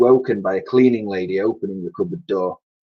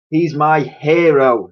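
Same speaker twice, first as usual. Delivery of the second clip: fond; 6 semitones higher, 5 dB louder, slower than usual. Acted. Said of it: "said Dotty".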